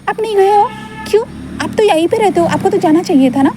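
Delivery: put-on high, amazed little-boy voice; a small boy shocked at the grown-up; surprised child. Wing can't believe Gaga never went, high astonished child voice.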